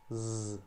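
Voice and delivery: long buzzing "zzz"; sustained Z sound. Shown as a demonstration. Teacher isolates the final sound for students, a sustained buzz. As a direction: hold a voiced Z by itself. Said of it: "tem um som de Z".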